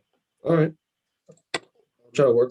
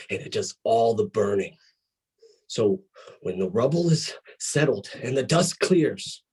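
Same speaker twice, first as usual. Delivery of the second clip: menacing wrestling promo; 4 semitones lower than usual. Acted. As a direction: deliver a threat, phrase by phrase, dying away to a low close.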